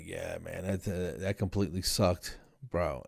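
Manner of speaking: low, soft, weakened pet voice; weary patient. Acted. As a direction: mumble it like a drained patient after surgery. Voices the bandaged guinea pig.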